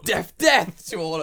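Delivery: loud, high, drawn-out mock slogan; mock rallying cry. Friend echoing a meme slogan, hammed-up shout. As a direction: belt it out like a joke battle cry.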